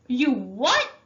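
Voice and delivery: loud, high disbelieving shout. A narrator's reading. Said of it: "he was shouting".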